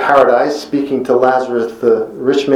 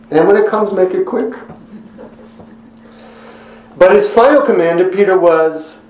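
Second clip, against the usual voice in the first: ordinary delivery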